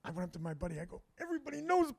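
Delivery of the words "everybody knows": high-pitched paranoid confession; acted paranoia. Stoned comic confiding in his buddy, acted high-pitched paranoia. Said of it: "I got a little paranoid".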